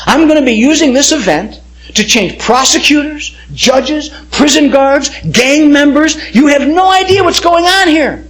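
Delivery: God's voice swelling to a shout; rising shout. Retold or imitated. God answering Tim's complaint, rising to a shout.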